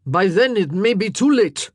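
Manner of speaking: loud, menacing king; a threat before storming out; warning. A narrator's reading. King warning the queen, loud and menacing. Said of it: "Francois warned".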